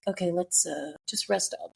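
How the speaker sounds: soft and drooping, imitating a tired man; quiet, defeated comfort; small sad voice. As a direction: let the words shrink away. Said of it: "It got so small and so sad".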